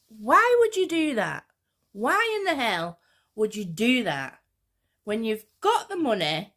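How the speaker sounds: loud, high disbelief at herself; why would anyone, really; exasperated. Real, not acted. Exasperated rhetorical question to viewers.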